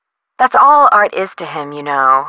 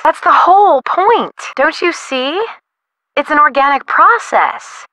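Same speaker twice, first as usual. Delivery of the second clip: high, exclaimed insistence; emphatic insistence; 7 semitones higher than usual. Acted. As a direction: press the point, pitch jumping up. Artist insisting on her point.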